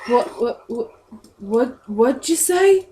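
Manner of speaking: stammering, rising to high disbelief; startled guard, pitch jumping up at the end; flustered, dumbstruck guard. Acted. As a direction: stumble over the question as if gobsmacked. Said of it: "his jaw opens a little bit".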